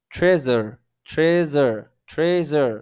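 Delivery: "ট্রেজার" loud, with a blended zh in the middle; pronunciation drill. Shown as a demonstration. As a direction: sound out "ট্রেজার" firmly, separated by pauses. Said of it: "আমরা যদি পড়ি তাহলে বুঝতে পারবো".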